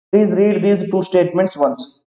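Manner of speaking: ordinary talk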